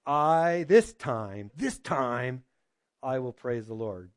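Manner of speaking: a hint of hushed devotion. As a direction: say it slowly, as a vow of praise. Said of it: "she finally said".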